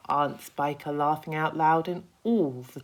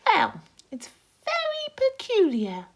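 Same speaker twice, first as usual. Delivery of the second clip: much higher, louder cocky insect voice; a boastful little bug, pitched way up; Centipede voice; 8 dB louder than usual. Acted.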